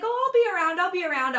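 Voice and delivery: high, light reassuring tone; breezy politician. Retold or imitated. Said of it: "And he's like".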